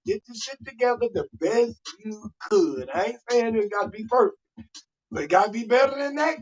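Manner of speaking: normal talking voice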